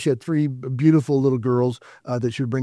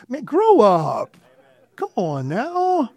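exasperated scolding; 8 semitones higher, much slower than usual. Real, not acted. Scolding gamers from the pulpit.

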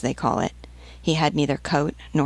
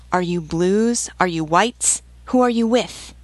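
rapid-fire questions rising to a high demand; pressing interrogation; 3 semitones higher than usual. A narrator's reading. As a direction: press harder with each question. Sergeant demands to know her side.

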